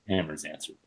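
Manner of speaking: plain reading voice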